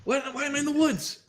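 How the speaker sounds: high, stammering mock alarm; frantic, high-pitched demand for answers; panicked questioning. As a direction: squeal it in hammed-up panic. Frightened NPC demands an explanation.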